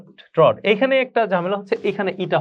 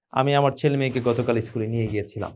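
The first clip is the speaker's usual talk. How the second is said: usual speaking voice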